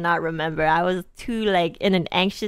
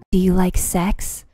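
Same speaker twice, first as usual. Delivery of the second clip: slow, breathy come-on in her ear; mock sultry whisper; 3 semitones lower, 4 dB louder, slower than usual. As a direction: lean in and ask it slowly, seductively. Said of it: "this stripper lady whispers in my ear".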